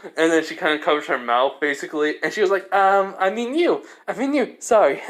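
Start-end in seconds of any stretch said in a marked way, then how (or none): 2.7-5.0 high-pitched, hurried backtrack; acted flustered correction. Kaneko covering her slip to Issei, flustered. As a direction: backtrack awkwardly, pitch climbing.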